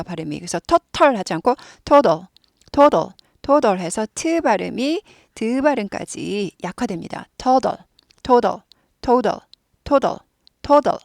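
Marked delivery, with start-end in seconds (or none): 0.7-2.3 total with the second T softened toward a D, todal. Shown as a demonstration. Teacher contrasting Korean and English total, careful demo. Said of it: "뒤에 T는 약화돼요". 7.4-11.0 total said todal, second T softened, repeated; softened t drill. Shown as a demonstration. Teacher leading a repeat-after-me drill, careful demo. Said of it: "많이 따라하세요".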